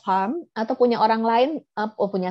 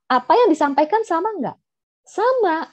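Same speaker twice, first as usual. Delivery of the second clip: ordinary talk, nothing marked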